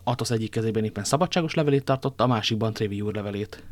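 ordinary delivery

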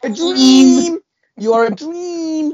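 high, slow swooning voice; dreamy distant voice. Acted. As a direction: sigh it out, lovestruck and floaty. Gushing praise for Statman John.

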